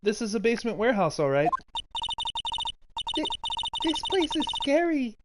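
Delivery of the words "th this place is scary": put-on higher little-girl voice, lightly scared; Pearly, timid girl. Pearly in the basement, a touch scared. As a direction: play a small nervous girl.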